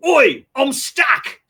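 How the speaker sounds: slow shouted Aussie Wolverine; Australian accent. Retold or imitated. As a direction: bellow it in a broad Aussie accent. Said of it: "the thing I remember most is".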